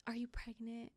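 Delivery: hushed, voiceless question; whisper. Retold or imitated. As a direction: breathe the question out without voice. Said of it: "So she was like".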